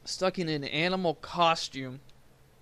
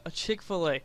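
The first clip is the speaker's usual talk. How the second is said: normal talking voice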